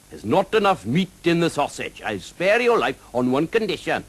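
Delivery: high, lilting Welsh dragon voice, friendly and teasing; a jolly Welsh dragon drawing out his words. Acted.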